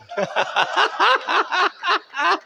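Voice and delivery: high laugh at his own line; hearty laugh. Real, not acted. Poet laughing with the audience at his joke, high laugh. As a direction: burst out laughing.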